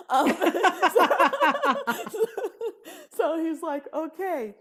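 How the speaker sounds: high, loud laughter spilling over; cracking up mid-story; laughing hard. Real, not acted. Laughing at her own joke to the host.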